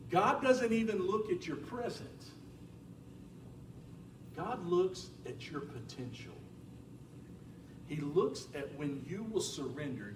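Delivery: usual speaking voice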